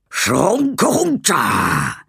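high, slow creature gibberish; drainpipe creature. Acted. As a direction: stretch the nonsense word out like a little gutter gremlin.

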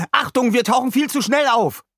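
very high, loud cry of alarm; alarmed warning. A narrator's reading. Rick warns the crew they surface too fast, alarmed. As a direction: shout the warning at the top of your range.

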